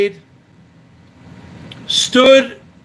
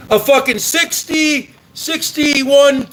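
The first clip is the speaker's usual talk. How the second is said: ordinary talk, nothing marked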